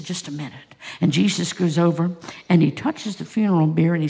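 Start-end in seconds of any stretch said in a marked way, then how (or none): 0.0-0.7 soft, calm command. Acted. Jesus halting his disciples, quiet and calm. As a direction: quietly hold the disciples back.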